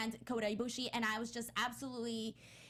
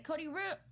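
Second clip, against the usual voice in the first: imitating a broadcast chopped off abruptly; raised pitch, break off dead on "Root"; cut off mid-word; 6 semitones higher than usual. Co-host shows how commentary ended, cut off mid-word. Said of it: "and then that's it".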